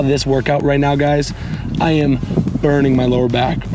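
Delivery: ordinary talk, nothing marked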